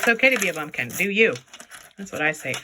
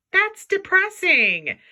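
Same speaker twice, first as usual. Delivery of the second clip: mock sad; 11 semitones higher, 5 dB louder, much slower than usual. Sad earring.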